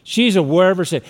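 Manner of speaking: normal talking voice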